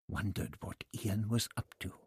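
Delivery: usual speaking voice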